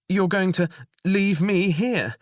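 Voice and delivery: high, whining plea; whining panic. Acted. Goblin pleading with the jailer, whining and panicked. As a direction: whine it out in mock panic. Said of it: "in a whining, panicked tone".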